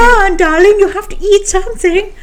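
a sweet, high British mum coaxing; British accent. Retold or imitated. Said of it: "trying to do a British accent".

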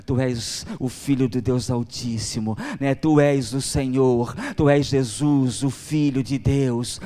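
slow swelling declaration of faith; Peter's loud confession. Acted. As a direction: proclaim it as Peter to Jesus. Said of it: "Pedro, né, olha pra Jesus e fala".

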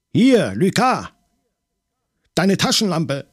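high, loud call; urgent shout. A narrator's reading. Maigret, in the dark fight, shouts for Lucas's flashlight. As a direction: yell for the light.

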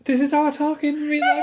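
high laughing protest. Real, not acted. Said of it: "just talk jollier".

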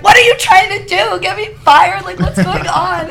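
flustered employee yelling at the member over the hat; exasperated outburst. Retold or imitated. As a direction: throw it back at him in outrage. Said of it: "I'm like".

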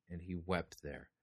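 soft, sorrowful fade; hushed. Acted. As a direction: let the weeping line fade out gently. Reading of Joseph weeping.

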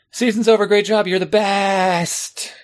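high, slow, gushing congratulation; sugary coach voice parodying trophy culture; mock cheery praise. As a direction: lay the fake praise on thick. Mocking end-of-season praise.

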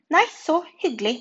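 high, loud, offended retort; huffy. A narrator's reading. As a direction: toss it back in a huff. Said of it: "sa Gulla snurt". (snurt, lys og høy stemme)

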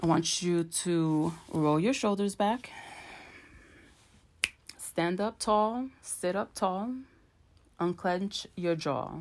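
calm them down, step by step; guided relaxation. Acted. Leads a breathing exercise.

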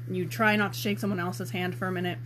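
usual speaking voice

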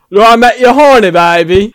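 cheesy Austin Powers impression in a forced American accent; American accent attempt. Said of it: "I can't make you do this".

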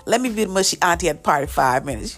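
ordinary talk, nothing marked

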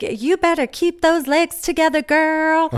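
high-pitched Southern sass imitation; Southern twang. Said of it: "always try to do the Southern twang".

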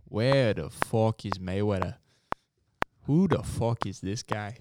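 normal talking voice

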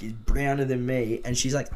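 usual speaking voice

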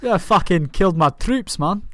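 slow Scottish grievance; mock-angry Scot; Scottish accent. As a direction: complain in a put-on Scottish accent. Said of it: "in a Scottish accent".

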